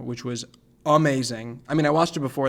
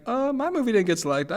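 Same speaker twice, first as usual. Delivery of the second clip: a hint of a higher, sheepish self; imitating himself; 10 semitones higher than usual. Telling festival guests his film was rejected, mildly higher sheepish tone. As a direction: lift the pitch and sound a bit embarrassed. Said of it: "And I'm like".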